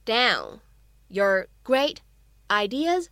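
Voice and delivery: slow model reading, a pause after each small chunk; word-by-word slow reading. Shown as a demonstration. Teacher models the long sentence for learners, slow and word by word. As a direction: say it piece by piece, slowly and separately.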